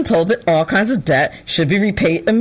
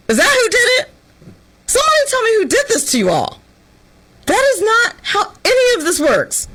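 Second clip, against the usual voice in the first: much higher, exasperated lecture, fast; mock scolding; 11 semitones higher than usual. Scolding young graduates about entitlement, high exasperated. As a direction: demand answers in disbelief.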